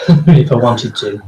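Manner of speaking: usual speaking voice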